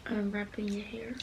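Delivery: whispering. Real, not acted. Whispering while cuddling in bed.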